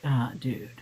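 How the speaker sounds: normal talking voice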